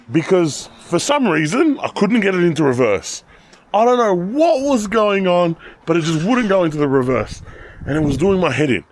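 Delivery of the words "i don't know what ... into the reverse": exasperated. Real, not acted. Car stuck, frustrated retelling.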